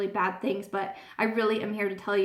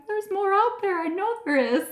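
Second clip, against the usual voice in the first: high pleading insistence; high insistent; 10 semitones higher than usual. Retold or imitated. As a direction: plead it, pitched way up. Herself insisting, retold.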